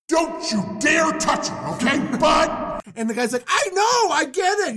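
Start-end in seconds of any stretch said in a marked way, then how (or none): 0.1-2.8 high, loud booming threat; big sky voice. Acted. God warns Abimelech off Sarah, big sky voice. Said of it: "You're a big sky voice". 3.5-4.8 high, loud, hurried concession; exasperated agreement. Acted. Abimelech interrupts God's threat, exasperated agreement. Abimelech cuts off the booming God.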